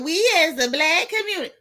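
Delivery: hammed-up spokesman impression; mocking voice. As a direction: mimic the self-appointed spokesman. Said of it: "Since they love to say".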